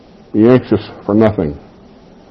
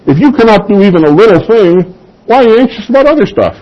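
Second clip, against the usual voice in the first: high-pitched challenge, speeding up at the end; Jesus, mock incredulous; 10 semitones higher, faster than usual. Preacher voicing Jesus questioning worriers, high and incredulous. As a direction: raise the pitch and question them in disbelief. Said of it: "he's saying".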